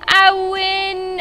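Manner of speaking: loud, high victory cry; high-pitched gloating cheer; triumphant. Real, not acted. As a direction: crow it loud with long-held words. Celebrating a win in game.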